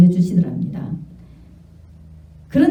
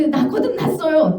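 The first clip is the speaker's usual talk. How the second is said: insisting; 8 semitones higher than usual. Retold or imitated. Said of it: "이 사모님은 계속 이야기하고".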